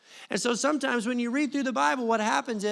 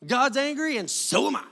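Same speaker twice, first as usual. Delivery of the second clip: a hint of a self-righteous religious grump; lightly lampoon the angry churchgoer; mock angry zealot; 5 semitones higher, 4 dB louder than usual. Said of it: "angry religious people love to paint God".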